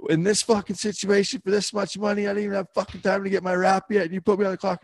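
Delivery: high-pitched retold tirade at the judge; furious rant. Rider screams at the judge over the clock, furious. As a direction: lay into him, barely holding it together. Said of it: "got right up in his face".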